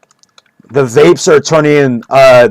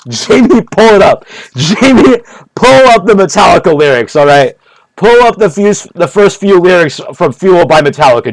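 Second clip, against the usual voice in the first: mock Joe Rogan; 4 semitones higher than usual. Imitated Joe Rogan ordering producer Jamie, high excited calls. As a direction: bark orders at Jamie, all worked up.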